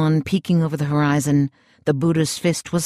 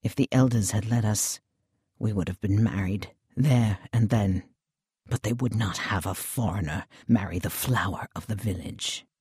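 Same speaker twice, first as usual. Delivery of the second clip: softer, bitter regret, lower; 4 semitones lower, 6 dB quieter than usual. A narrator's reading. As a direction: let the bitterness sink into quiet words. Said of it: "The Englishman's voice was low, bitter".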